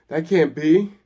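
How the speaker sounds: loud, high-pitched denial; shrieking protest. A narrator's reading. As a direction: shriek it in disbelief. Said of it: "he shrieks".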